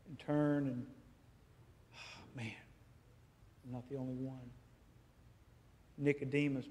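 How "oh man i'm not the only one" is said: hushed realization. Acted. Joseph imagined at the cross.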